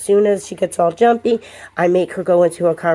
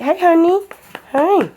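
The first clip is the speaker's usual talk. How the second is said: put-on much higher baby talk greeting; gushing dog greeting; 11 semitones higher, 3 dB louder than usual. Shown as a demonstration. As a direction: tempt the dog with a gushing hello. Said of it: "tempting the dog to jump".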